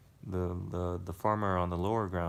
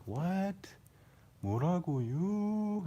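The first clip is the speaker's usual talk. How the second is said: high, long drawn-out question; comically slow, high-pitched "huh?"; slow-talking dialect impression; 11 semitones higher than usual. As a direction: stretch it out slowly in a raised pitch. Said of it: "his, uh, speaking is so slow".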